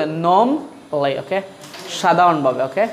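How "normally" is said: normally slowly split, "nom ... lee"; slow R-less "nawm", then "lee", for normally; British pronunciation. Shown as a demonstration. Teacher modelling for learners, slow "nom-lee". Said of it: "এই শব্দটা কি বলে?".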